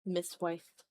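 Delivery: ordinary delivery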